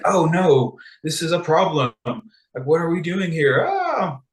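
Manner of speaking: flustered fretting over a plot snag; mock panic. Characters stuck with an irreversible edict, mock-panicked.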